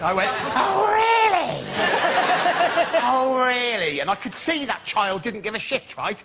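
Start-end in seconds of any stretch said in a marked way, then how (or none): 0.5-4.0 high, slow, sarcastic teacher; mock furious. A teacher mocking a late pupil with high sarcasm. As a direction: pitch it up in mock outrage. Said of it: "I was furious".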